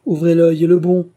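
loud, high ship captain's command; captain's loud order. Acted. Captain Hansen to his mate, a loud order. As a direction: bark the warning across the deck. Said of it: "dit Hansen au second".

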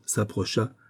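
ordinary delivery

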